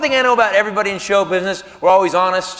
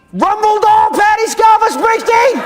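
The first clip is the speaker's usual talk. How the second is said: very high, loud fan shriek; starstruck freak-out; 7 dB louder, slower than usual. Acted. Fan naming her album to the star, starstruck freak-out. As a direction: shout it high, stretching the name in awe.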